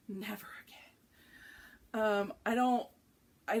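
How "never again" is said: slow exhausted whisper; weary whisper. Real, not acted. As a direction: breathe it out, totally burned out. Burned out on shirts.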